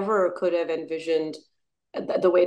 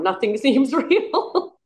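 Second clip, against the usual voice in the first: laughing; 6 semitones higher, slower than usual. Real, not acted. Laughs at surreal life.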